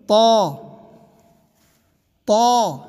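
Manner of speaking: تا heavy, rounded to an aw vowel; full, rounded تا; thick t. Shown as a demonstration. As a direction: say تا loud and full-mouthed. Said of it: "موٹا".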